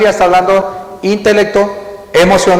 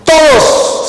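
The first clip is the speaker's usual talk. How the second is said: todos lifted high and stressed; emphatic todos; 7 semitones higher than usual. Shown as a demonstration. Preacher pointing out todos to the church. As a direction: punch the word todos up high. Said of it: "segunda vez".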